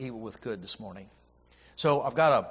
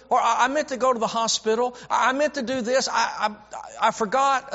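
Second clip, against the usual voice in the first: higher, flustered mimicry of a forgetful churchgoer; preacher mimicking a congregant's excuses, higher; mock fretful excuses; 8 semitones higher than usual. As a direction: play the sheepish excuse-maker, stammering. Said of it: "How many times have you said".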